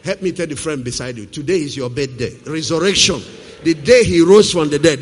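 ordinary delivery

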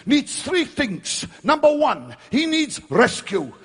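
rising from loud preaching to high, slow shouts of rescue; building to shouts. Retold or imitated. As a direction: climb to a desperate cry for help.

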